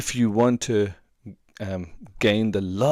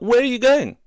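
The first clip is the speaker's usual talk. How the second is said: much higher, louder mock wife calling after him; wife's voice; 10 dB louder than usual. Wife calls after husband leaving room, high loud mock demand. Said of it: "she was like".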